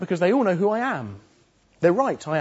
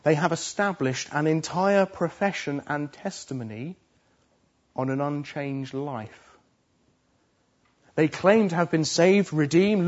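ordinary delivery

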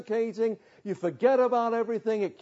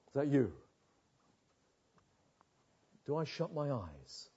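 low and much softer, a frightened inner murmur; hushed denial; 6 semitones lower, 8 dB quieter than usual. Acted. Sinner hushing himself, refusing to face God's anger, in a low frightened murmur. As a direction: mutter to yourself, scared to look.

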